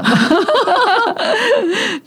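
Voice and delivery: a long high-pitched burst of laughter. Real, not acted. Guest laughing about her dad's many trades, a long high laugh. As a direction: laugh up high and let it fall away.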